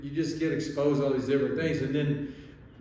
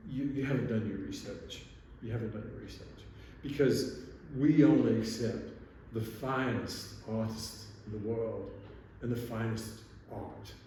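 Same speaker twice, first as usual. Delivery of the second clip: soft, halting mock-British gallery lady; imitated British art dealer, hushed and measured; British accent; 4 dB quieter than usual. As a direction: put on a snooty British accent. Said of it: "I'll try to do my best British accent".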